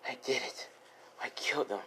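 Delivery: barely voiced, near whisper; hushed confession. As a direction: whisper it to yourself after the deed. Acted stunned confession after killing.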